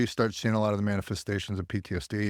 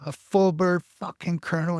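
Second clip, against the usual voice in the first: imitating a colonel snapping, higher and sharper; angry outburst; 7 semitones higher, 3 dB louder than usual. Colonel laying into a lying soldier, imitated higher angry outburst. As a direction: let the anger break through on the last words. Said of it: "he just starts laying into me".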